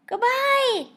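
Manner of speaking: high, loud call. A narrator's reading. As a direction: call after the teacher. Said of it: "the students called".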